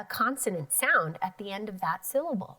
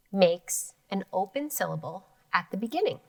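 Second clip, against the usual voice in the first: ordinary talk, nothing marked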